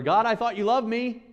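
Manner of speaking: high, loud anguished plea; despairing cry to God. Pretend. Daniel imagined crying out to God, high anguished plea. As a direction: cry it up to heaven, betrayed.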